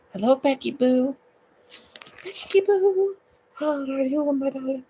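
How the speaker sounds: high-pitched hammed-up Romanian greeting; Romanian accent. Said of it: "use my most famous Romanian accent".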